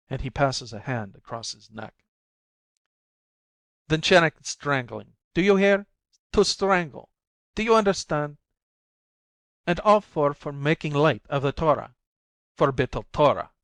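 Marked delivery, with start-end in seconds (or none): 3.9-13.5 louder and higher, pressing each question; menacing lecture. A narrator's reading. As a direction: frighten her with every word. Husband terrifying wife with punishments.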